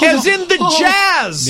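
high, slow, hammed-up emphasis; exasperated agent. Imitating an agent explaining who is calling, exasperated. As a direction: spell it out for a clueless client. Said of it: "his agent calling him and saying".

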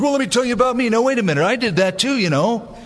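high-pitched, butting-in show-off; mock braggart. Braggart hijacking a conversation about someone else, hammed-up bragging. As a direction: cut in eagerly, hungry for the attention. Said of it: "when you brag, you just can't stand it".

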